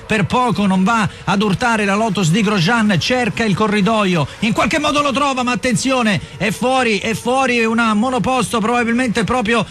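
excited rising play-by-play; live race commentary. Acted. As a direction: call the start live, voice climbing high. Live start commentary, pitch rising.

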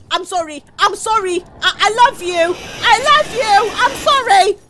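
high, loud pleading that builds; frantic apology. Real, not acted. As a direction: cry out sorry with rising urgency. Panicked apology to teammates.

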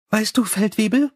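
breezy vampire Sally, chatty. Acted.

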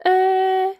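high, loud hesitant uh; an embarrassed dad stalling; sheepish uh. Acted. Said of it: "says Daddy Pig".

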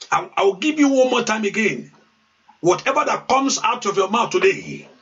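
preaching at full force, chanting and calling out; fervent prayer. Acted. Pastor urging his congregation to pray aloud, preaching at full force.

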